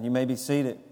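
ordinary talk

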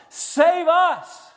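high, loud cry from the pulpit; crowd's scream. Acted. Preacher imitating the crowd around Jesus, top-of-the-lungs shout. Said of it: "screaming at the top of their lungs".

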